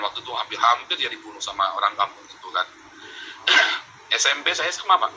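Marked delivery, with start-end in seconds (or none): none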